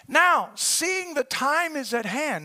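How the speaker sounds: slow, deliberate reading from the pulpit. Real, not acted. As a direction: read it slowly for effect. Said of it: "I wanted to read 'em slow".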